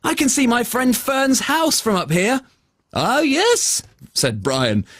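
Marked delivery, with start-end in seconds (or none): 0.0-2.4 put-on excited boy. Travis flying excited. 2.9-3.8 unicorn voice. Acted. Brian answers.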